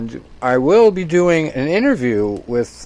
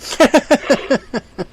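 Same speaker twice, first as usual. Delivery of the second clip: high burst of real laughter; sudden delighted laugh; loud laugh; 5 dB louder than usual. Real, not acted. Host laughs at the story.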